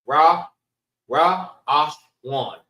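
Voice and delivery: Ra first alone, then Ra, Ash, Wan; three syllables. Shown as a demonstration. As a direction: sound out Ra... Ash... Wan, stepping down in pitch. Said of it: "Three syllables".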